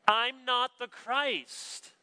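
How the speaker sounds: high, slow, deliberate proclamation; emphatic denial. Acted. John tells the questioners who he is not, emphatic denial. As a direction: declare it without hesitation, every word drawn out. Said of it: "It's emphatic".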